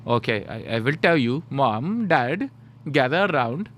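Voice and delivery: trolling, hammed-up Indian accent; exaggerated Indian accent, slowing and swelling at the end; mock Indian accent. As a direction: mimic the tutor's Indian accent to amuse the parents. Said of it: "I would be such a troll".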